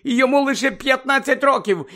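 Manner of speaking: high, loud pleading outburst; distressed exclamation, pitched high; desperate cry. A narrator's reading. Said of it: "вигукнула розпачливо місіс Візлі".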